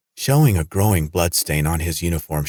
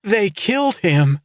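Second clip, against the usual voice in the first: high, slow, horrified scream; terrified scream. A narrator's reading. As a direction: wail it out in terror. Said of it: "She was screaming again".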